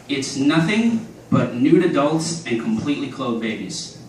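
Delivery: ordinary talk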